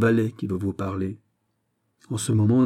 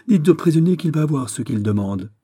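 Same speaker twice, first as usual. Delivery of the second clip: loud, higher, urgent command; brisk cry; 6 semitones higher, 5 dB louder than usual. A narrator's reading. Governor dispatches the sergeant to the prisoner, urgent cry. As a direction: send him off with the order. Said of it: "s'écria vivement Besmo".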